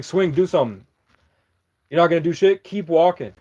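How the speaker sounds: mock tough-guy taunt; taunting challenge. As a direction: goad him to swing. Taunting man to fight.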